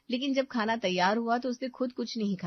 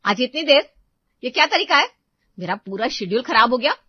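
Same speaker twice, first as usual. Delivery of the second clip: loud, much higher angry shout at the maid; 5 semitones higher, 12 dB louder than usual. Acted. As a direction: yell at her for being late. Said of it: "सीमा उसे देखते ही चिल्ला उठती है".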